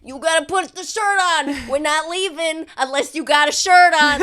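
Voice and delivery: put-on loud shouting over the kids; yelling mom. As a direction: yell at the kids to get dressed. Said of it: "and she's like".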